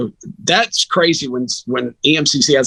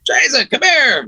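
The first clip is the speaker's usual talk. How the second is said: calling out; 12 semitones higher, slower than usual. Retold or imitated. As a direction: shout his name across the field. Coach calling across the field.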